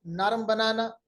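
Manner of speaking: ordinary delivery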